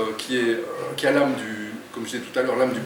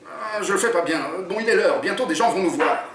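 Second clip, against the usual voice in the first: lively read-aloud narration; story reading; 3 semitones higher than usual. A narrator's reading. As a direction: read the chapter to draw listeners in. Author reads his book aloud.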